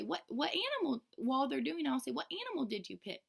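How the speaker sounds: a touch of the bright teacher voice; teacher asking the kids, lightly bright. Shown as a demonstration. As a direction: ask each child, lifted.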